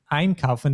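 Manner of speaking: einkaufen with its first syllable EIN stressed. Shown as a demonstration. Teacher explaining separable verbs, EIN stressed in einkaufen. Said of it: "the ein is stressed".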